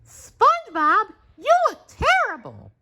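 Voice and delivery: very high, loud alarmed voice; Sandy exclaiming. A narrator's reading. Sandy shocked at SpongeBob's state, very high exclaiming voice. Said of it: "Sandy exclaimed".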